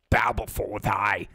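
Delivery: dark lord. Pretend. Mock regal decree to viewers.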